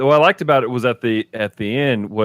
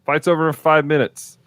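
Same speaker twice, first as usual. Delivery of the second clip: mock announcer voice; 5 semitones higher than usual. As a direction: sing it out, brushing it off. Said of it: "and it's like".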